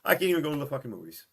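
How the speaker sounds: exasperated rant. Retold or imitated. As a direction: spit it out fed up. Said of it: "he's going".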